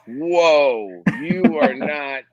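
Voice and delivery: slow, high, drawn-out astonishment; mock shock. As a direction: imitate a stunned fan, stretching the words. Imagined shocked listeners' reaction.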